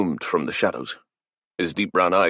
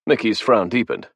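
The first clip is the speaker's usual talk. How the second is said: plain reading voice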